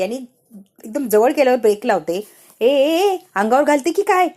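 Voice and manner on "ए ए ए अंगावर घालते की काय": louder, higher startled shouting; startled yell. Acted. Panya yells at Payal as she drives at him, startled and loud. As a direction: yell as the scooter nearly hits you. Panya nearly run over.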